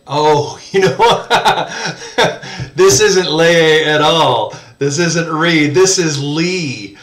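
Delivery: laughing through the words at a silly slip; amused, laughing realization; laughing at own mistake. Real, not acted. Teacher laughing at his own misreading.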